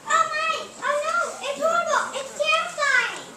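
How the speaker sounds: high, very loud screaming for help; panicked shouting. Acted. Terrified elephants scream at butler for help, loud panic. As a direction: yell for help in total panic.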